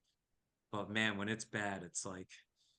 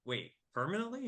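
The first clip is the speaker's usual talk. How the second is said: surprised disbelief; 9 semitones higher, faster than usual. Retold or imitated. Hearing he moved out for good, startled. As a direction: pitch jumps up on a startled question.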